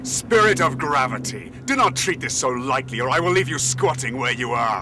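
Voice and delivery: loud, commanding warning; stern rebuke. Acted. The wanderer sternly warns the dwarf, raising his voice. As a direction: put the dwarf in his place.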